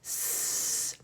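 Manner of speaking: long hissed S sound; stretched S. Shown as a demonstration. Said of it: "let's stretch it".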